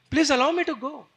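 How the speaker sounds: earnest, rising-intensity appeal; pleading. Acted. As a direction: beg mother for permission. Son begging mother's permission.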